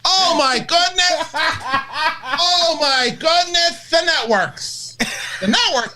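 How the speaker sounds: very high, slow, dramatic Vizzini; high, slow Vizzini wail; cartoonish dramatic Vizzini. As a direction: stretch it out high and theatrical. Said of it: "he's so cartoonish and dramatic".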